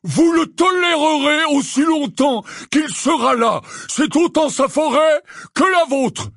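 furious high-pitched shout; loud, indignant outburst; exclaimed. A narrator's reading. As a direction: bellow back in defiance, voice way up. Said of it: "s'exclama-t-il".

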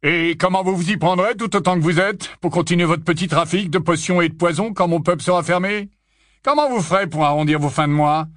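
barman's pointed, threatening questions; defiant barman. A narrator's reading. Barman threatening to end their potion trade, defiant. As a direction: needle them as the angry barman.

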